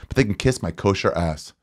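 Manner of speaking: usual speaking voice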